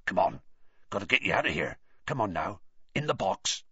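low raspy whisper; hoarse whisper. A narrator's reading. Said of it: "someone speaking in a hoarse whisper".

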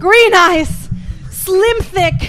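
loud, high, teasing imitation; hammed-up mock quote; mimicking a friend. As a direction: belt his list back at him in mockery. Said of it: "He's like".

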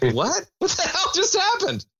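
high, laughing disbelief; baffled. Retold or imitated. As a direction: squeak out what the hell in disbelief. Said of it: "you're just like".

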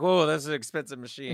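a put-on relieved foreman. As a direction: sigh over the machine, not the men. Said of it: "they're like".

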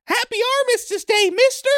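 put-on high excited child voice; little kid. Little kid greeting a stranger, high child voice. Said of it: "the little kid goes".

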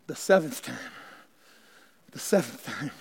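near-whispered repeat, very low and soft; hushed. Acted. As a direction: let it sink in almost silently. Preacher drops to a hushed repeat.

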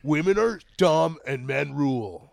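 lightly put-on loud macho bravado; hammed-up chauvinist bit, building in volume; macho character. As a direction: proclaim it like a mock manly man. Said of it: "I'm playing a character".

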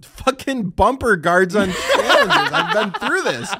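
slow words broken by real laughter; laughing while ranting. Real, not acted. Asked what he hates, he rants while laughing. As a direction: chuckle through the complaint about bumper guards.

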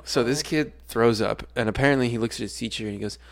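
normal talking voice

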